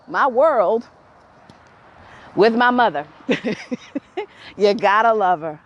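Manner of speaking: ordinary delivery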